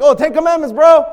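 acted-out eager answer; smart answer. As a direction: blurt it like someone catching on. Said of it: "get smart and be like".

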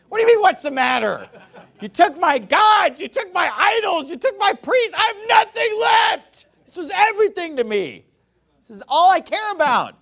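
high, loud, outraged shouting; furious yell. Retold or imitated. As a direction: rail at the thieves who left nothing. Preacher voices furious robbed Micah.

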